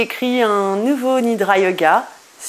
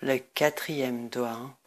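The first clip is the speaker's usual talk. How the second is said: guided meditation; 4 semitones lower, 8 dB quieter than usual. Acted. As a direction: guide listeners into deep rest, lower and much softer. Leads a Nidra Yoga meditation.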